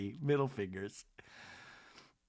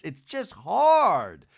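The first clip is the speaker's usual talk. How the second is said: strained; 3 semitones higher, 8 dB louder, much slower than usual. Retold or imitated. Quoting Angie struggling in water.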